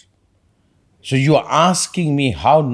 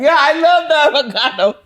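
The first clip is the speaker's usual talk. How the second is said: put-on very high, loud little-boy voice; mock crying child; 6 dB louder than usual. Boy thanking his mom for the avocado, mock crying. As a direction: wail it like an overwhelmed child. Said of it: "He's probably crying".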